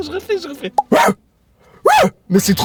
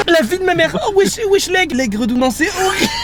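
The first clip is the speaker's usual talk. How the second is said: disgusted outburst; 11 semitones higher than usual. Real, not acted. Disgusted by the smell.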